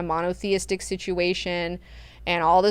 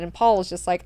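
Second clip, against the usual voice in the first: ordinary talk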